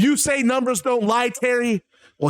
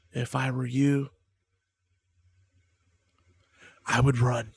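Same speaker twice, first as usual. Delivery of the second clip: creepy cult leader; 2 semitones lower than usual. Acted. Voices Bray Wyatt's creepy promo.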